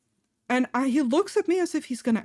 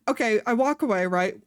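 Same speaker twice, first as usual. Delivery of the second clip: normal talking voice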